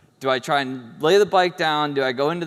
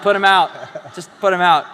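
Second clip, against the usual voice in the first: loud, raised-pitch command as mom; emphatic mom order; 4 semitones higher, 8 dB louder than usual. Retold or imitated. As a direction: imitate an exasperated mother giving orders. Mom orders doctors to sedate son.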